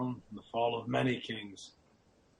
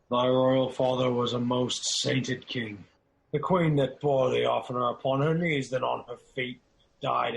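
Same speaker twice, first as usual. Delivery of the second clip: louder, grief-driven declamation; impassioned outcry; 2 semitones higher, 6 dB louder than usual. Acted. Macduff despairing over Scotland to Malcolm, loud. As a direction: let the anguish burst out.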